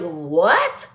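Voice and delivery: drawn-out, rising baffled "What?". Retold or imitated. Telling how she reacts to stepdad's slang, baffled. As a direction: let the confusion climb through the word.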